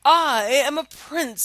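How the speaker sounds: slow proud prince. Acted. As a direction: announce it slowly as the prince. Prince voiced in story.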